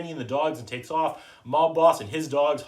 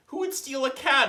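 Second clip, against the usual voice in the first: put-on little girl; 12 semitones higher than usual.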